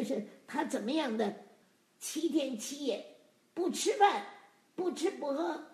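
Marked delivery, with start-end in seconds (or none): none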